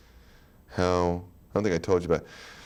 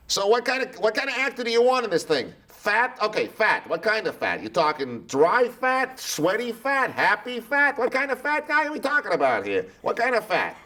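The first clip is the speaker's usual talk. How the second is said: much higher, hammed-up casting guy grilling you; TV producer impression. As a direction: play the pushy TV exec sizing up an actor. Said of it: "he'll be saying things like".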